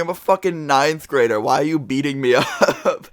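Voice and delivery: high-pitched plea with a laugh breaking in; retold incredulous protest. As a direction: act out protesting your innocence, half amused. Retold pleading with the attacker.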